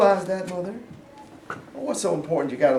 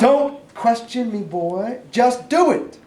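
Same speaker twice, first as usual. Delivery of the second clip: stern order; 7 semitones higher, 4 dB louder, much slower than usual. Acted. Sergeant orders private sharply.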